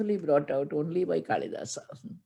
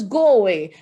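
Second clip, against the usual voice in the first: "Go away" louder, falling firmly on "Go"; 2 semitones higher, 8 dB louder than usual. Acted. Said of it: "She says".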